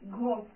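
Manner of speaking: "ghost" said "gost", no H sound; teacher demo of "ghost" with silent H. Shown as a demonstration. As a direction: drop the H in "ghost".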